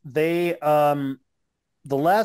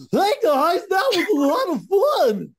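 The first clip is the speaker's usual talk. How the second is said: much higher and louder, goofy bubbly voice; 12 semitones higher, 4 dB louder than usual. Pretend. Orange Cassidy off camera greeting fans, goofy high voice. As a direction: pop out of character into a silly chirpy voice. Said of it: "has a really goofy voice".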